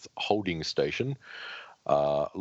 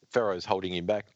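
ordinary talk, nothing marked

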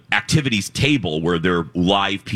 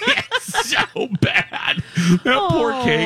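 laughing; 14 semitones higher, much slower than usual. Real, not acted. Laughing at melted cake.

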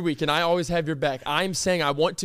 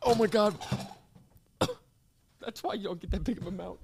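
usual speaking voice

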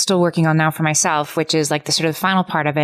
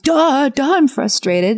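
hammed-up pitch-up of a sulky child's retort; kid yelling back; 9 semitones higher, slower than usual. Parent mimics a child yelling back at her, high mock whine. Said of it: "a kid yell back".

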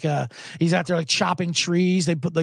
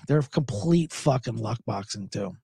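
ordinary delivery

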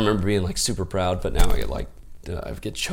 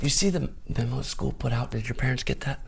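put-on light Canadian accent, a bit higher; Canadian teacher accent; 5 semitones higher than usual. Canadian teacher questioning a student, light Canadian accent. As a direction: lightly mimic a Canadian schoolteacher asking about a memo. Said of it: "I don't know if this accent's terrible".

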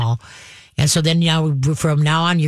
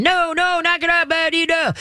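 much higher, frantic mock yelling; mock screaming; 12 semitones higher than usual. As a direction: imitate an owner yelling at a barking dog. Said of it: "we start screaming".

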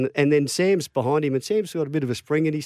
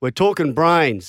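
stern coach, mock scolding; 3 semitones higher, 4 dB louder, slower than usual. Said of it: "he said".

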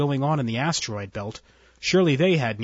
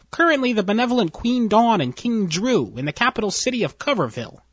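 higher, nervous underling voice; nervous officer; 7 semitones higher than usual. Acted. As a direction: report it anxiously to your boss. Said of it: "entered nervously".

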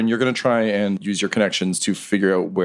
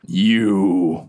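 menacing recognition. Acted. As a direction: spit it at your old enemy. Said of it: "and he's like".

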